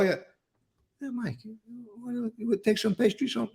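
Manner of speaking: softly offering a cookie, lightly voiced old man; low-spoken old mobster. Old mob boss offering young Mike a cookie, softly. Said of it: "They spoke very low".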